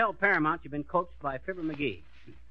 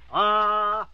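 loud, held dramatic "ah"; demonstrated "ah"; 6 dB louder than usual. Shown as a demonstration. Coach shows how to react to a cheating lover, dramatic "ah". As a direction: stop dead with a sustained "ah". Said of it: "it should be like this".

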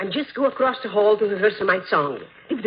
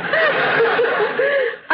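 delighted high laugh; 5 dB louder than usual. Real, not acted. [name] laughs at Fred's joke.

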